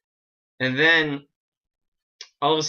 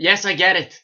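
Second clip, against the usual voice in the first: real irritated shout, higher and louder; raised voice; 7 semitones higher, 9 dB louder than usual. Real, not acted. Shouting back at someone telling them to lower their voice, raised voice. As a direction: call back loudly to someone off mic. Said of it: "tell me to lower my voice".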